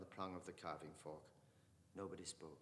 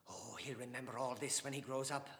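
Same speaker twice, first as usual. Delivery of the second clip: hot; 4 semitones higher, 6 dB louder than usual. Acted. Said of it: "said Dante hotly".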